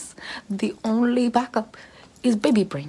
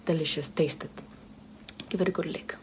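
ordinary talk

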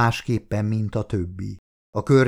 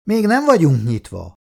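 raised, higher call toward the door; called out loudly; 6 semitones higher, 4 dB louder than usual. A narrator's reading. Café owner calls out to an unseen visitor, loud. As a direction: shout it over your shoulder, still busy. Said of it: "kiáltotta hangosan".

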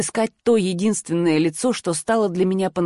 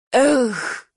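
high, rasping wheeze; strained croaking groan; hoarse gasp; 5 semitones higher than usual. A narrator's reading. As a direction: wheeze out a pained rasp. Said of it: "захрипела".

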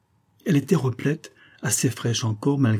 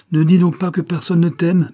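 higher, louder reproach; affectionate scolding; 7 semitones higher, 6 dB louder than usual. A narrator's reading. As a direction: scold her out of hurt love. Nurse scolds girl she adores. (reproche affectueux et vif)

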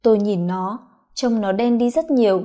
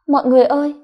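higher, louder call for help; crying out; 4 semitones higher, 5 dB louder, much faster than usual. A narrator's reading. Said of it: "chạy ra ngoài gào lên". (gào lên kêu cứu)

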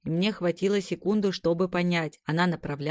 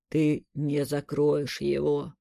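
slow, low menacing voice; slow, otherworldly threat; monster voice in head; 3 semitones lower, slower than usual. A narrator's reading. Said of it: "пронёсся голос у меня в голове".

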